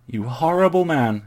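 high, loud playful scolding; mock outrage. A narrator's reading. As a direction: scold him with hammed-up indignation.

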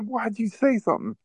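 confronting the friend who snitched; exasperated accusation; mad at friend. Retold or imitated. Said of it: "I was so mad at him".

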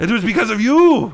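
put-on high, loud French-accented outburst; accusing shout. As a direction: hurl the blame as a furious French villain. French villain blames his rival.

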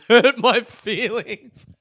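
loud, high, drawn out through laughter; laughing hard. Real, not acted. Comedian admitting he feels belittled, cracking up loudly. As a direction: burst out laughing while getting the words out. Laughing at own confession of hurt.

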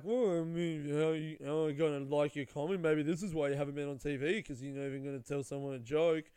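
mock someone badgering a comedian; aggressive needling. Girl badgers comedian who won't joke, imitated aggressive needling. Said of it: "like, aggressively going".

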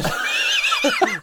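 real howling laugh; high shrieking laugh. Real, not acted. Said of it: "laughs out loud".

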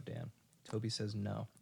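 normal talking voice

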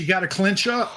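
high-pitched cartoonish coaching call; character catchphrase call. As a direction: call it out high, rising at the end. Player calls out a character's catchphrase.

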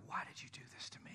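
a fast, breathless whisper; whispered toddler plea. Retold or imitated. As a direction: whisper it like a betrayed sick child. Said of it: "looking at you like".

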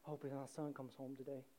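very soft, low wishful murmur; hope aloud, half to yourself; hushed, with long pauses; acted longing father. Waiting father, acted again.

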